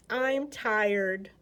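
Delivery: plain reading voice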